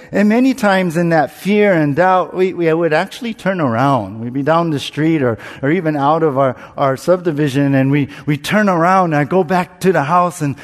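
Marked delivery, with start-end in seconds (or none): none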